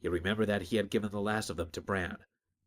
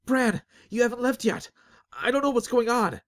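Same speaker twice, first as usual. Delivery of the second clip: high, loud frantic shouting; panicked shout; 14 semitones higher, 6 dB louder than usual. Acted. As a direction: call out desperately while running. Azric shouting to Bran, panicked.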